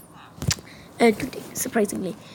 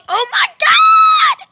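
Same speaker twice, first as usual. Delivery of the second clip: much higher, louder, stretched-out shriek; ecstatic scream; 7 dB louder, much slower than usual. Pretend. Student shrieking at her best friend in the hallway, stretched joyful scream. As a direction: scream it with pure joy, dragging every word.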